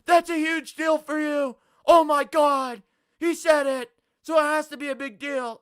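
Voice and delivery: loud, high hysterical mimicry; mock outrage. Streamer parodies viewers fussing over his preference, mock outrage. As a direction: shout it high as the overreacting accusers.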